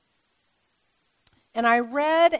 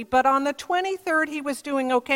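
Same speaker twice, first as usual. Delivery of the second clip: ordinary talk, nothing marked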